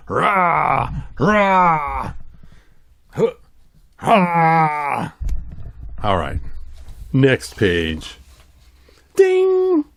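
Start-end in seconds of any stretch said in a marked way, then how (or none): 0.1-5.1 short hammed-up growling roars; mock roars. Showing the panther figure on camera, mock roars. 9.1-9.8 high sung ding like a page chime; ding sound. Acted. Turning the page for viewers, high ding.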